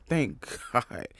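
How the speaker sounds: relieved laugh. Retold or imitated. Relieved after a heavy episode, laughing softly. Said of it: "And I'm like".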